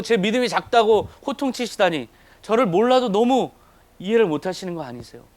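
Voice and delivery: high, loud pleading complaint; acted outburst of feeling wronged; aggrieved protest. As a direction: argue back at the Lord, building in indignation then easing. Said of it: "정말 억울할 거예요".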